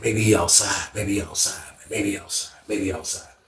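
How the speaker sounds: muttering to himself. Retold or imitated. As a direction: talk yourself into it under your breath. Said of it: "I was like".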